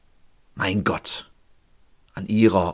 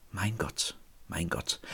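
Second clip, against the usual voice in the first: hushed; 4 semitones lower, 15 dB quieter, slower than usual. Acted. The forsaken cry, hushed.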